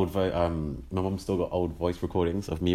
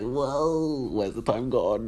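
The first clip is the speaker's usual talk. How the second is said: amazed; 5 semitones higher, much slower than usual. Real, not acted. Amazed at time passing.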